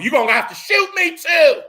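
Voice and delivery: high, loud defiant shout; defiant yell. Retold or imitated. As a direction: shout the dare, daring him to shoot.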